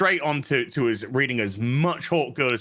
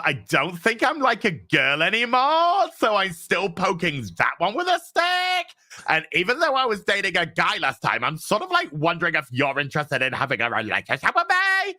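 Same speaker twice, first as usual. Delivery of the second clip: mocking whiny voice; 6 semitones higher than usual. Mocking comic dialogue.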